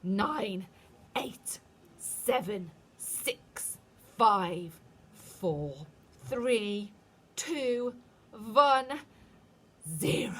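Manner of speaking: a touch of witch voice, some counts hissed; witch countdown. A narrator's reading. Witch counting down before the spell. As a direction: tick off the numbers with relish.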